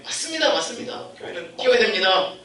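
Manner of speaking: high excited outburst; imitating churchgoers; eager congregation echo. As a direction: shout agreement like congregants. Congregation echoing.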